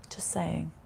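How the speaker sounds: a cheeky whispered aside; whispered just saying. Real, not acted. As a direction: whisper it conspiratorially. Cheeky aside about her friend.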